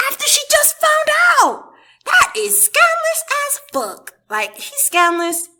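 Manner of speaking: very high-pitched, loud indignation; outraged exclamation. Real, not acted. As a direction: exclaim in scandalized disbelief, voice shooting up. Outraged rant at his nerve.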